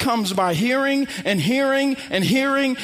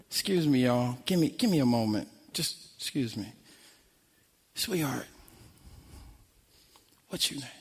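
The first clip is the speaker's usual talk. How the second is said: much lower and softer, gentle and unhurried; calm quiet authority; 9 semitones lower, 8 dB quieter than usual. Retold or imitated. Preacher playing the pastor, softly addressing the possessed woman. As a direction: speak softly, no need to yell. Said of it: "So I get to play Pastor Rick".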